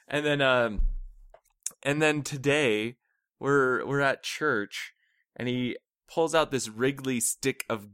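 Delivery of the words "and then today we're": usual speaking voice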